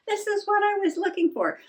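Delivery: can't believe it was here all along; surprised discovery. Real, not acted. Finds the lost calendar page.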